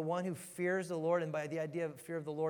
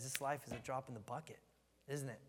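normal talking voice

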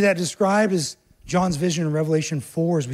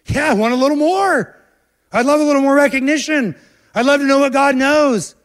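higher, louder, grabbing at more; eager longing; 9 semitones higher, 7 dB louder than usual. Acted. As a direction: voice the hungry heart that wants more. Voicing Adam and Eve's greedy hearts.

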